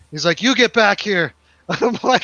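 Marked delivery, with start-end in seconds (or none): 0.4-1.3 raised, higher calling-back voice; retold order. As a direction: call after someone walking out. Shatner calling after him, acted.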